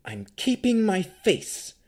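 high, loud, slow defiance; fierce, high-pitched refusal; through gritted teeth. A narrator's reading. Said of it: "said through gritted teeth".

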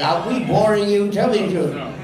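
ordinary talk, nothing marked

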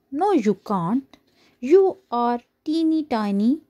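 usual speaking voice

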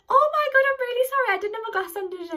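high, flustered apology; embarrassed apology. Retold or imitated. Apologising to the stranger at the bus stop, high flustered. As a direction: gush out an embarrassed sorry.

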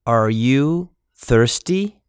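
ordinary talk

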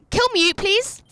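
ordinary talk

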